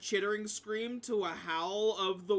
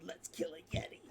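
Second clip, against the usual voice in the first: soft, voiceless old-lady imitation; mock a tiny old woman, hushed; small old lady; 7 dB quieter, much faster than usual. Picturing the old halfling eager to fight, small old-lady voice. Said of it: "this small old lady going".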